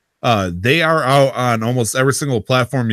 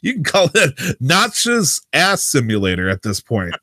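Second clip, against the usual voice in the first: high, animated dismissive retort; hammed-up "whatever you want" voice; mock quote; 6 semitones higher than usual. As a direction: toss it off high and incredulous, as an acted quote. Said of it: "I'm gonna say it's like".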